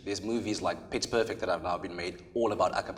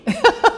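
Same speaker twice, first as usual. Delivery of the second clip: short, high burst of laughter; 15 dB louder than usual. Real, not acted. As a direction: let a quick high laugh escape. Laughs after the imitation.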